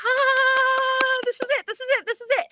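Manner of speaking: loud high "ah" easing into excited squeals; thrilled realization. Retold or imitated. Runner seeing friends near the finish, thrilled high squeal.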